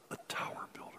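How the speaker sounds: whispered searching question. Acted. Preacher asking the congregation to search themselves, whispered. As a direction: drop to a whisper and ask.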